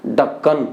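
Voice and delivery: ढक्कन with a low, unaspirated d; Haryanvi ढक्कन. Shown as a demonstration. Teacher showing the Haryana form, ढक्कन low. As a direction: say ढक्कन dropping the breath, low. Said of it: "वहाँ उच्चारण होगा".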